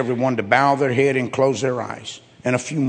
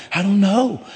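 lightly voiced guilty shrug, higher on "I don't know"; mock-innocent confessor passing the blame; sheepish excuse; 5 semitones higher than usual. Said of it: "You're like".

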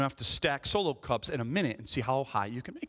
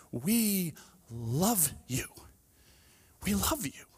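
tender; 5 semitones higher, much slower than usual. Retold or imitated. Telling the teens they are loved.

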